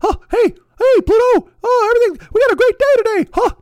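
loud, very high cartoon mouse falsetto; Mickey Mouse. Cartoon mouse greeting Pluto, absurdly cheerful high impression. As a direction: squeal it out like a chipper cartoon mouse. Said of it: "the Mickey Mouse fucking texts".

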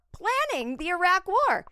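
high, loud disbelieving repeat; the comic can't believe who she served; outraged exclamation. Real, not acted. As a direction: yell it in outrage at the absurdity.

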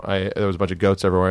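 ordinary talk

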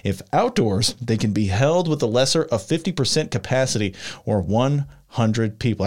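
ordinary delivery

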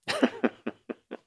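high, breaking-up giggle; amused laugh. Real, not acted. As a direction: laugh at your own bad voice. Cracks up at own attempt.

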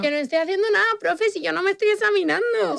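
protesting pupil. Acted. Pupil protesting innocence to the teacher, high-pitched indignant whine.

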